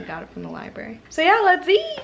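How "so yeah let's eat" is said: excited. Real, not acted. Excited to eat pizza.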